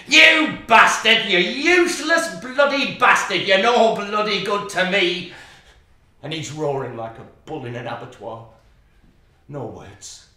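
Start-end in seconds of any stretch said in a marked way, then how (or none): 0.1-5.3 screaming at him. Acted. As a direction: scream it at the drunk husband. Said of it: "She's screaming at him".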